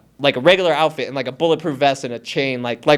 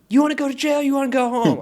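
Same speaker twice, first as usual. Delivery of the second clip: high-pitched mock movie-cop ultimatum; intimidating cop; 9 semitones higher than usual. Storyteller as the cop pressuring him, mock intimidating. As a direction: imitate a cop offering two choices.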